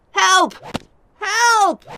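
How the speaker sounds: high, loud panicked cries; shouting for help. A narrator's reading. Lawyer being beaten cries for help, high and frantic. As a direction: yelp for help as the whip comes down. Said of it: "Wakem shouted".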